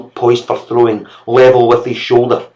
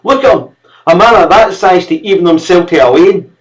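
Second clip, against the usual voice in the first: high, loud Scots scolding; furious mother; 7 semitones higher, 6 dB louder than usual. Acted. Mother railing at the servant. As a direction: point him out in disgust.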